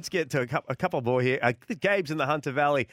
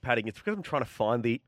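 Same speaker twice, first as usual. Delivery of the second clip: normal talking voice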